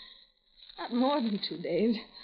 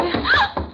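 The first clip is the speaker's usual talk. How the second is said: startled high gasp; sharp high gasp; 6 dB louder than usual. Acted. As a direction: gasp in sudden fright. Blind woman stumbles.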